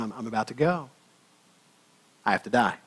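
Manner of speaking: quiet, somber Jesus. Acted. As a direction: say it hushed, like grave news to friends. Jesus tells disciples of death.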